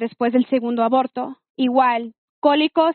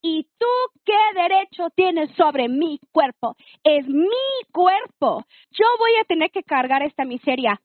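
retold indignant rage; 4 semitones higher than usual. Woman confronting boyfriend over her own body, indignant. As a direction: confront him like a lioness, drawing out each key word. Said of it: "salió la leona y me dio un coraje".

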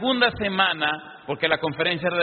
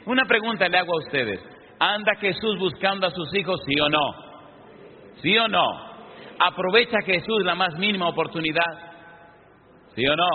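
preaching; 4 semitones higher than usual. Acted. Pastor challenges the congregation.

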